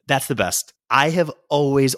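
ordinary talk

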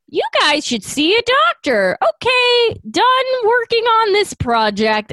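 high, chipper cartoon hero voice; mock Goku voice. Podcaster mimics Goku waving off the villains, high and chirpy.

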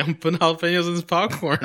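high, laughing reply; laughing quote. Retold or imitated. Telling his date about the popcorn, high laughing reply. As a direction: laugh through the boast. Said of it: "And I was like".

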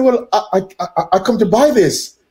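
mock innocent customer, stammering slightly; retold meek explanation. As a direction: explain yourself haltingly, acting out the story. He answers the confronting shopkeeper.

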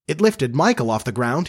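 plain reading voice

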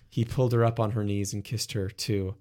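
ordinary delivery

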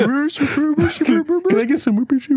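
very high slow mocking squeak; squeaky mock voice. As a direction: stretch it out in a tiny high voice. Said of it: "mocking Worcester sauce".

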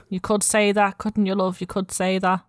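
a light Scouse market-stall mum; lightly voiced Liverpool accent, friendly. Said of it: "while I'm doing a Liverpool accent".

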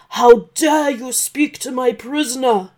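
loud furious dragon roar; angry dragon shout. Acted. Dragon furious that Santa spoke to his prisoner, loud shout. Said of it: "shouted the dragon".

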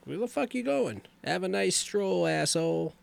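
high mocking jeer; sarcastic jeer. Kincaid jeering at the sleepwalking Joey, a high mocking jeer. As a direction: sneer at him as he leaves. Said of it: "he's like".

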